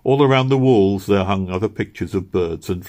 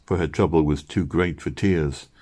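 ordinary delivery, nothing marked

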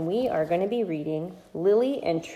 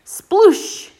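loud high sploosh; splash sound; 12 semitones higher, 11 dB louder than usual. Acted. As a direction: make a big splash noise.

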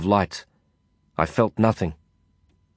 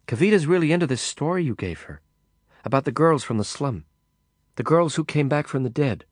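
put-on higher, chatty young woman making conversation; Lisa's lighter voice; 5 semitones higher than usual. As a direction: voice her a bit higher and brighter in pitch. Lisa voiced in narration.

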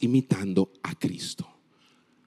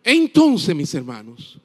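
high-pitched preaching, stretching the key words; prédica enérgica; 9 semitones higher, 6 dB louder, slower than usual. Real, not acted. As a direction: drive the point home to the congregation in a raised preacher's voice. Returns to preaching at full force. (predicación apasionada, voz elevada y palabras alargadas)

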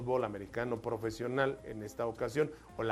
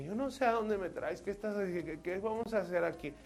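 put-on higher, anxious young woman; nervous girl voice; 6 semitones higher than usual. Girl in the truck asking what they will do, anxious and high. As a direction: fret about where he's taken you.

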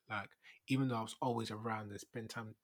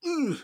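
high squealing ooh; excited ooh; 12 dB louder than usual. Real, not acted. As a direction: let out a high delighted ooh. Hips from yoga.

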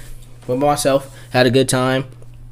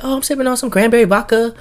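higher-pitched imitation of her reply; mimicked woman; 11 semitones higher than usual. Retells woman's answer.

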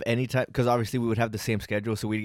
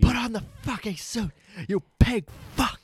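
angry high yell; 10 semitones higher than usual. Retold or imitated. Mock furious boss yelling at Batman.

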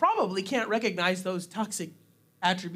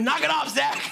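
higher, louder mock scold from a parent; 6 semitones higher, 4 dB louder than usual. Preacher imitating parents scolding him, raised mock scold. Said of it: "sounds a lot like my parents".

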